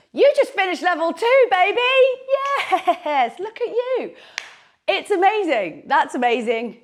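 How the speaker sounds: high, loud joyful cheering; excited cheer. Real, not acted. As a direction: celebrate them with a big cheer. Celebrating the finished level.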